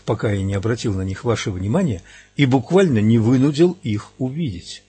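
usual speaking voice

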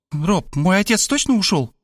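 put-on high, chirpy young girl voice; bubbly sorority girl. A narrator's reading. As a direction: bounce it like an excited teen. Varvara girl voice.

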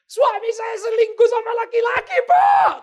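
very high shouted wail; hammed-up hysterical cry. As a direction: imitate a wife screaming out her shock. Distraught wife's shocking revelation. (menjerit histeris menirukan istri)

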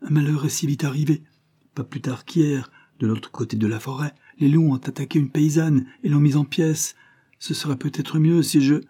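put-on old coachman. A narrator's reading. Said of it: "reprit le cocher". (vieux cocher qui insiste, voix plus aiguë)

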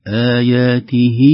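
"آياته" with the kasra on ha drawn into a long ee; vowel lengthening. Shown as a demonstration. Teacher demonstrating the madd on "آياته", slow. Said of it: "مددنا الكسرة في الهاء".

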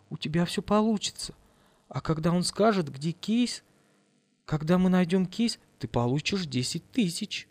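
soft, calm persuasion; softly steady reassurance. Acted. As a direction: talk her down quietly. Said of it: "заговорила очень спокойно".

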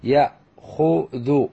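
يأخذ very slowly as ya-khu-dhu; teacher modelling يأخذ piece by piece; syllable by syllable. Shown as a demonstration. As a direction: sound out يأخذ with pauses between syllables. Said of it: "مضارعه".

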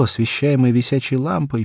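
usual speaking voice

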